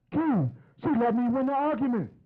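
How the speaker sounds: high, eager news to his brother; bursting to tell Keith he won; excited brag. Retold or imitated.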